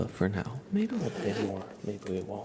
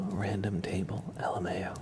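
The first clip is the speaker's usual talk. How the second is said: whispering; 3 semitones higher than usual. Real, not acted. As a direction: whisper it close to the mic. ASMR stream whisper.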